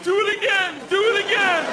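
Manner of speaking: high, loud, laughing "do it again"; laughing plea. Retold or imitated. Bellhop doubled over begging for a repeat, high and laughing. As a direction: beg through laughter. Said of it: "doubled over like".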